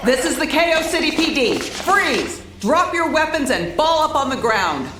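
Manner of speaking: commanding cop bark; police order. Acted. Cop shouting orders at the cornered pair. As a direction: freeze them where they stand.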